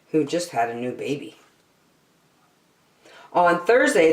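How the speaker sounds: ordinary delivery, nothing marked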